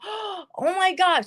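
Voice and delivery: slow, high gasping oh my God; gasped oh my God. Retold or imitated. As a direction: draw it out in alarm. Gasps on seeing the ex.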